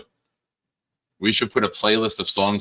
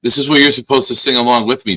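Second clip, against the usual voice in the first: 1 loud urging; 4 semitones higher, 9 dB louder, faster than usual. Real, not acted. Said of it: "urging co-host to sing".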